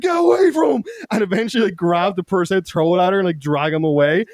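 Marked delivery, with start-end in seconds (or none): 0.0-0.8 high, loud yell; hammed-up high-pitched warning shout; acted shout. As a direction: yell it like fending someone off. Acts friend yelling during tug-of-war.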